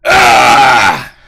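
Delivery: low, loud burst of "ah"; short blast of a yell; loud shouted "ah". Real, not acted. Streamer alone on mic, loud low shout.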